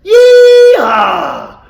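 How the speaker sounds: loud cowboy yeehaw shout. Shown as a demonstration. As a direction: holler it like a cowboy. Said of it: "give me a yeehaw on three".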